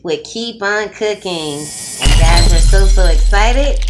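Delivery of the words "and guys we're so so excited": loud, thrilled announcement; excited. Real, not acted. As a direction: burst out with excitement. Announcing the challenge.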